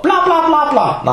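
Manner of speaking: high, mocking blah blah. Mocks people who only talk. (hånligt bla bla i høj tone)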